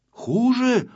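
high, startled "Хуже?"; alarmed question. A narrator's reading. Pyostrenky hears bad news and asks "Хуже?", frightened. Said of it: "испугался Пёстренький".

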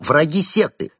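high, loud alarmed cry; shouting. Acted. Chimp monk denouncing the visitors as enemies, loud high shout. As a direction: yell it out as a warning. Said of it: "выкрикнул брат Бенедикт".

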